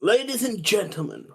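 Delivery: advert voice. Shown as a demonstration. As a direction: announce it like a TV advert. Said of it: "Try do your advert voice".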